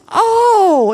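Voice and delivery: imitating his sudden thrill; excited oh. A caller telling her about his faith, excited. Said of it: "he got all excited".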